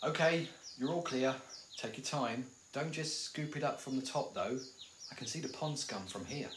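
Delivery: quiet, careful instructions; hushed. Acted. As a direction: keep it well down so nothing hears you. Keeping quiet near the lake.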